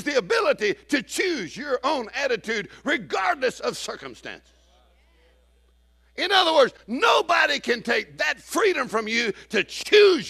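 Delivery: high, impassioned preacher's delivery; preaching. Real, not acted. Preacher telling congregation to choose their attitude, impassioned preaching. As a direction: build a ringing, high-pitched exhortation.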